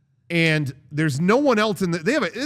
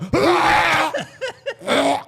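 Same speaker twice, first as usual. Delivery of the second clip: loud, high imitated heaving; mock retching; 12 semitones higher, 7 dB louder than usual. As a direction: heave noisily in the middle of the song. Acts being sick over the music.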